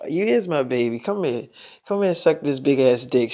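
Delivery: low coaxing. Retold or imitated. Coaxing girlfriend intimately.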